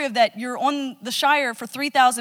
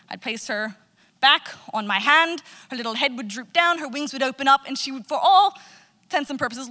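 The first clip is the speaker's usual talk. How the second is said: ordinary talk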